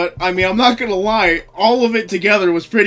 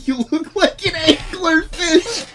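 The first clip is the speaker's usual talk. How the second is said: higher, breaking into laughs between words; can barely get it out for laughing; real giggling mockery; laughing insult; 9 semitones higher, 6 dB louder than usual. Real, not acted. Laughing while insulting a viewer.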